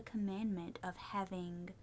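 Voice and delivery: breathy whispered aside; whispering. Real, not acted. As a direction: drop to a secret whisper.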